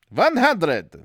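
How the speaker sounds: loud, high hammed-up German accent; mock German accent. Narrator repeating the page number, mock German accent. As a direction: bark it in a cartoon German accent.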